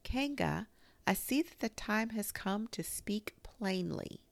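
high, earnest little voice putting on courage; small creature speaking up bravely; high-pitched, determined announcement; trying to be brave. A narrator's reading. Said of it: "in as brave a voice as he could".

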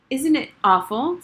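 high, loud falsetto. A narrator's reading. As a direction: squeak it up in a prim falsetto. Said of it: "in a falsetto".

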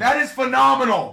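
loud, quick exclamation; exultant praise. Real, not acted. Streamer celebrating a one of one, loud exultant praise. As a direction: fire out the superlative.

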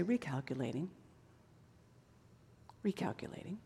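very soft, low, hushed recalculating; gentle whisper-soft Spirit. Retold or imitated. Holy Spirit softly guiding the wanderer, hushed. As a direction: breathe it out gently. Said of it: "But the Holy Spirit will say".

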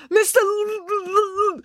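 high, stuck stammer on the L. A narrator's reading. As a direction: struggle to call for help, stuttering. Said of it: "Joe tried to stammer for help".

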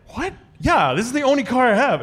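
high, loud disbelieving "what, yeah"; incredulous. Retold or imitated. Incredulous reply to nephew's question.

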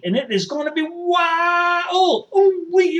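high, long-drawn whoop; whooping excitement. Acted. Supporter recalling the summons to D C, excited whoop. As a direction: stretch it out high like a rallying holler.

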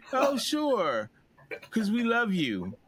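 high mock-sweet friends' reply; a hint of fake sweetness; mocking friends. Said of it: "my impression of Never Have I Ever".

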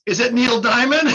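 phone clerk mocking the store clerk, laughing, sarcastic; mocking question. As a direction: ask it like the answer is obvious, with a laugh. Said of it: "So he's mocking me".

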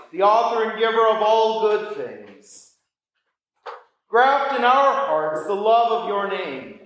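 normal talking voice

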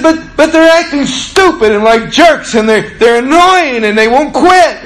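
put-on high, loud complaining rush as the girl; mimicking upset teen girl. Pastor voicing the girl complaining about other kids, high whine. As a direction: whine it up like a kid tattling. And she said.

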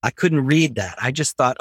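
ordinary delivery

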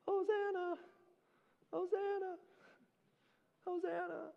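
high, soft hosannas echoing off far walls; distant echoes. Acted. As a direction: sing out hosanna like an echo from afar. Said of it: "these echoes bouncing off of the temple courtyard walls".

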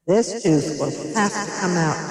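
measured storybook pronouncement; announced. A narrator's reading. Doctor DeSoto giving the fox his verdict, announcing. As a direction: announce a verdict, lightly acted. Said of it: "Doctor DeSoto announced".

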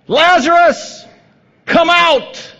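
loud, high command into the tomb; commanding call. Acted. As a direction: call the dead man out with full force. Jesus calls Lazarus from tomb.

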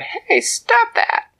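high, loud and slow ant protest; indignant tiny ant. A narrator's reading. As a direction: tell the troll off sharply.

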